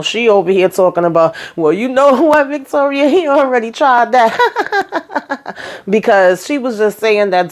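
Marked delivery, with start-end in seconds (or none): 1.6-4.4 higher voice breaking into mock laughter; imitating laughter. Lily taunting Victoria, laughing as she talks. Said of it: "hysterical, laughing nonstop in between saying all this stuff". 4.4-5.8 high, imitated hysterical cackle; hysterical laughing. Lily cracking up at Victoria, hysterical laughter. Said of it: "Lily is cracking up".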